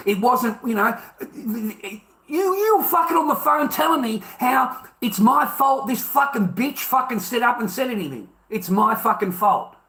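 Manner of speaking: high, heated indignant rant; real outrage. Real, not acted. Telling a caller off for saying it's his fault, outraged. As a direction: rail indignantly at being blamed. Outraged about being blamed by phone.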